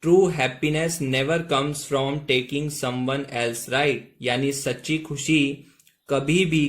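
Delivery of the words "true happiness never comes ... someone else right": very slow, word by word English; slow model reading. Shown as a demonstration. Teacher reads the whole sentence to students, very slowly. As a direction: lay each word out slowly and clearly. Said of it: "एक साथ देखिएगा".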